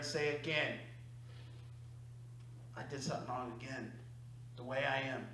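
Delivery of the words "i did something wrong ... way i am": much softer and lower confession; quiet admission. Retold or imitated. To himself after a failed conversation, a soft resigned admission. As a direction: say it low, owning the fault. Admitting fault inwardly.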